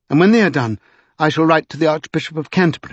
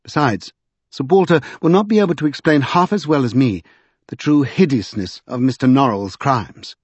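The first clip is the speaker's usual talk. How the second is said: ordinary delivery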